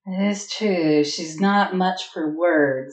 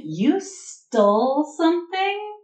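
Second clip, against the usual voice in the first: drawn-out, high-pitched teasing reproach; playfully scandalized question, stretched out; mock chastising; 10 semitones higher, slower than usual. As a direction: pretend to scold, slowly and much higher. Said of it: "sounds like she's chastising Chalice".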